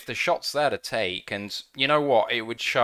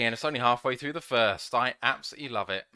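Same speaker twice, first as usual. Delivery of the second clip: ordinary talk, nothing marked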